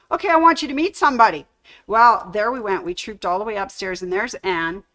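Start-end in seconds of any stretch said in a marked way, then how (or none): 0.1-1.4 imitating an eager boss, higher, louder and fast. Betty, eager to introduce her to the owner, quick and bright. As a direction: rattle it off like a boss who can't wait to show you.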